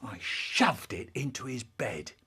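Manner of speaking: plain reading voice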